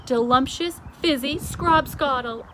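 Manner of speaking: high, loud eager giant; BFG enthusing. A narrator's reading. The giant explains frobscottle to the Queen, eager. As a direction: gush about the drink. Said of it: "the B F G answered".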